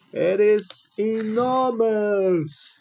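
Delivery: slow, excited cry; cried out. A narrator's reading. Jack crying out to his parents in wonder, slow. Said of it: "cried Jack".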